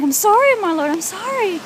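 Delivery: high-pitched imploring apology, acted out; retold pleading apology. Acting out her dream apology to the Lord, high pleading. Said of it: "And I'm like".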